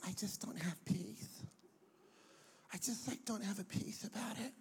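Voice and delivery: soft whispered doubt; whispering. Retold or imitated.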